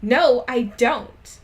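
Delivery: loud, slow shouted denial. Acted. [name] denying her crush to Willow, loud slow shout. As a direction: bellow it slowly at the friend. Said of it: "I shouted".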